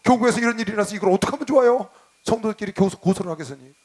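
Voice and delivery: high, loud and frantic, like breaking bad news; flustered panic. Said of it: "전도사가 그냥 난리가 났어요".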